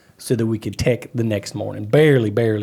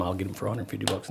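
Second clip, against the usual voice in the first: much softer, hushed sneaky line; mock sneaky aside; 5 semitones lower, 14 dB quieter, faster than usual. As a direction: hush it like a secret to the cop. Retold sneaky whisper to cop.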